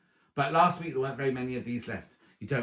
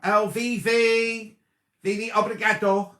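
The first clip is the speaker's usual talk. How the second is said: delighted greeting; 8 semitones higher, 9 dB louder, slower than usual. Real, not acted. Greeting a viewer who joined live.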